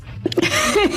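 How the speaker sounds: a real burst of high laughter; laughing. Real, not acted. Laughing at the tortilla joke with her co-hosts, high bursting laugh. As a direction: break into a sudden high laugh.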